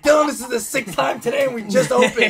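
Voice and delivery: high, loud, fed-up manager voice; exasperated manager. Retold or imitated. Manager snapping at a server who keeps complaining, exasperated. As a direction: imitate the boss losing patience. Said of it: "And he's like".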